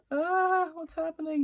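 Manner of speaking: high-pitched cartoon fretting; mock freaked out. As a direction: wail it like a flustered unicorn. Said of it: "he's so freaked out".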